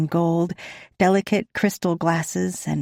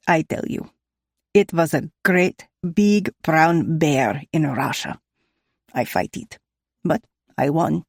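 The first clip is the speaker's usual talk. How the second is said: put-on Russian accent. A narrator's reading. Nikolai tells his bear story.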